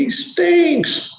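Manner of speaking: high, loud protest; mourners complaining. Retold or imitated. A mourner protests to Jesus, high loud complaint. Mourners voiced in retold story.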